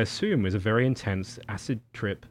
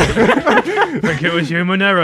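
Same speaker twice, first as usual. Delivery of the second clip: put-on much louder, higher laughing Cockney accent; Cockney Hal; 9 semitones higher, 15 dB louder, slower than usual. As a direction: ham up a Cockney computer through laughter. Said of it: "this Cockney Hal".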